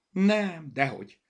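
loud, high opening denial; livened up. A narrator's reading. Zorka reassures Berta it's no teasing, livened up. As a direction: deny it brightly, with new energy. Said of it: "folytatta felélénkülve".

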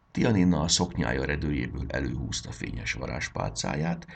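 plain reading voice

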